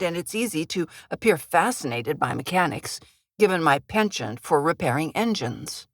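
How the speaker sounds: plain reading voice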